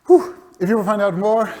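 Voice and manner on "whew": sharp high exhaled "whew"; relieved whew. Real, not acted. Speaker finishing a rushed presentation, relieved whew. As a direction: let out a real whew after a long run. Relief after rushing through talk.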